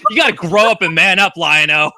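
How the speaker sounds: loud, high mock order to a child; hammed-up tough-love telling-off; mock scolding. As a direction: scold him loudly, playing it for laughs. Acted scolding of a crying cartoon child.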